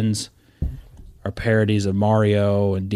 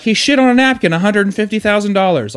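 snooty art dealer; 9 semitones higher, 3 dB louder than usual. Retold or imitated. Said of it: "It's like".

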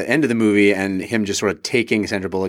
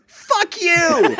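put-on little girl. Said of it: "the little girl turns to the reporter and goes".

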